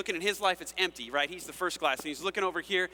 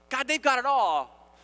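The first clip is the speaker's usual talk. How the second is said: louder, slow aggrieved whine; envious complaint; 7 semitones higher, 7 dB louder, slower than usual. Acted. A believer complaining to God, slow envious whine. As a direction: moan it, feeling cheated.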